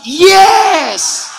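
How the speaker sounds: long, high, loud YES; clueless mock shout. Preacher re-enacts answering without knowing, loud mock shout. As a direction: belt out a drawn-out mock yes. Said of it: "Saya jawab".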